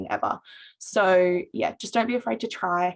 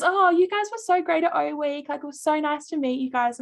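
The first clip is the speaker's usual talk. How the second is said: much higher, gushing praise retold; gushing girl; 8 semitones higher than usual. Girl praising the team's O Week stall, high gushing. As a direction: imitate the girl raving about the team. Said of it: "And she goes".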